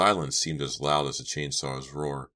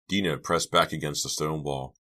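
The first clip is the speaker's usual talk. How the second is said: plain reading voice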